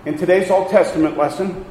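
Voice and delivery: ordinary delivery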